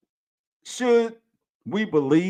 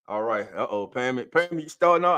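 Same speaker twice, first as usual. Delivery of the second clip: ordinary delivery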